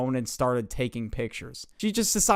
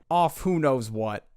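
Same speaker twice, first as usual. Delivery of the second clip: ordinary talk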